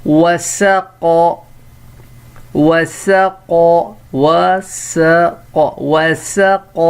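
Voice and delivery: وسق stretched very slowly, wa-sa-qa; slow model reading. Shown as a demonstration. Teacher to pupils, drawn-out model word. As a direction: sound out وسق syllable by syllable. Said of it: "اگلا".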